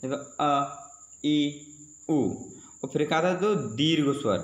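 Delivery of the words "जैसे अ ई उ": अ, ई, उ said short and clipped; short vowel demo. Shown as a demonstration. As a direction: say अ, ई, उ quickly, each held only briefly. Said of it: "इसे बोलने में दोस्तों कम से कम समय लगे". (ह्रस्व स्वर छोटे बोलकर)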